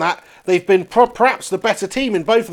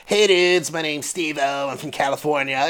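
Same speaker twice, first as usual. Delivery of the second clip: put-on Californian accent, slightly lowered voice; Steve-O; 4 semitones lower, 6 dB quieter than usual. Introducing himself as a celebrity, Californian accent. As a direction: do a Californian dude. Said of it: "all sorts of different American accents".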